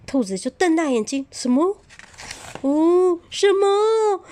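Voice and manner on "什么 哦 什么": high, loud startled cry; shocked rabbit. Acted. Rabbit shocked at the hedgehog's request, high startled cry. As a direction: squeal it out in disbelief. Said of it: "它很惊讶".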